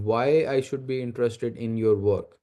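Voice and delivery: normal talking voice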